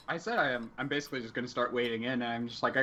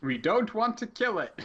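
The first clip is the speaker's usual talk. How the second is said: slower, raised voice, called across; louder repeat; 8 semitones higher than usual. Acted. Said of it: "I can't hear you".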